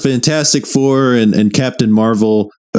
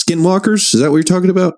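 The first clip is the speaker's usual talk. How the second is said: surprised; 9 semitones higher than usual. Real, not acted. Recalling a name, high rise.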